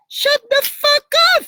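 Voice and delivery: very high, loud mock tantrum yell; retold shout. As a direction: scream it in exasperation, half playing. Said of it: "I would just shout".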